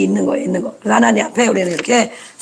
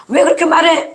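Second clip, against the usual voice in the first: pitched-up retort, mildly sharp; high scolding question; 7 semitones higher than usual. Retold or imitated. Adult snapping at a child, higher pitched. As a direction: toss it back like a nagging parent.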